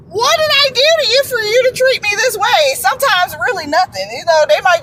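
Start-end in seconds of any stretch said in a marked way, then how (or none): 0.1-2.7 higher, pleading mock question; mock hurt plea. Mimicking someone mistreated asking why, hammed-up pleading. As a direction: whine it like a hurt victim. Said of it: "and you have to ask them".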